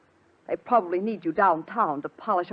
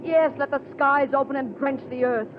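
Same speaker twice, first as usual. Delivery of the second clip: high, loud, exultant proclamation; triumphant; 8 semitones higher, 5 dB louder than usual. Acted. Helen hails the rain for her rebirth, triumphantly. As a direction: call down the storm in wild triumph. Triumphant invocation as storm breaks.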